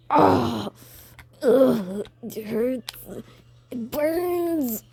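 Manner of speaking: mock pained groaning, rising into a wail; Bakugo in pain. Storyteller playing Bakugo burned by Deku, hammed-up agony. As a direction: ham up being burned.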